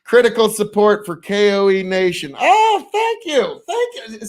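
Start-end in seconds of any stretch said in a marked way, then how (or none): 2.4-4.3 high, slow delighted thanks; flattered surprise. Real, not acted. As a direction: gush like you're touched. Flattered by viewer praise.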